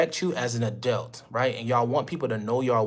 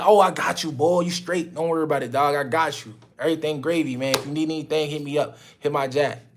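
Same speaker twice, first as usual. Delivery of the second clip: casual slang reply; 3 semitones higher than usual. Retold or imitated. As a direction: brush it off like talking to your boy. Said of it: "If I'm like".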